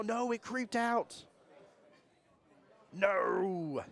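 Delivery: high, slow, stretched-out groan of disbelief; a drawn-out high wail as the ball creeps; real dismay, slow and high; dismayed cry. Real, not acted. Dramatic shot fails live.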